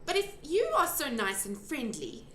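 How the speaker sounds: a hint of a young girl asking; young girl Sophie. A narrator's reading. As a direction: speak up as a curious little girl. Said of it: "Sophie said".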